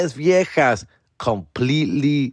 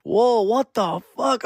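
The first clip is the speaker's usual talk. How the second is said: stunned whoa; 8 semitones higher, slower than usual. Retold or imitated. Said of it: "stunned reaction".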